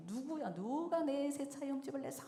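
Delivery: high-pitched mock yell of outrage; retold angry yell. Dad storms in over his scratched new car, mock angry yell. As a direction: act out a dad's outburst, not truly shouting. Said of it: "막 소리를 지르면서".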